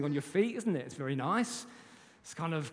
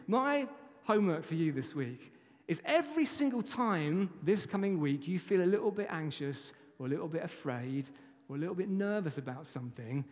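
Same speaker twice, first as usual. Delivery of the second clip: ordinary talk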